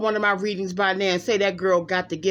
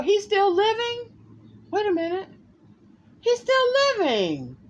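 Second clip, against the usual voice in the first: much higher, startled disbelief; surprised; 8 semitones higher than usual. Real, not acted. Checking a ballplayer's birthday, shocked he might be alive, pitched high. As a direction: gasp it out in disbelief.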